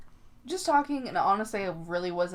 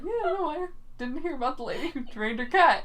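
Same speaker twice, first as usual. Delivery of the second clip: higher voice holding back laughter; hiding a smile; 8 semitones higher than usual. Retold or imitated. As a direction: act out answering with a suppressed giggle. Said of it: "trying to sm-, like, hide my smile".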